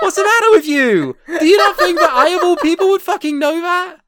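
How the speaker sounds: loud, high laughing tirade; incredulous rant. Real, not acted. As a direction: rant in disbelief, cracking up.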